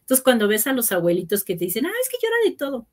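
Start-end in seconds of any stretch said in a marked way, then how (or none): 1.9-2.9 very high, fast mocking quote; imitación burlona. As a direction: rattle off the complaint in a squeaky mock voice. People quoted about crying grandparents. (voz muy aguda y rápida, imitando con burla)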